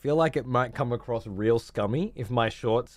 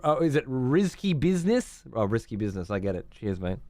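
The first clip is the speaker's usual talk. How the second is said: ordinary delivery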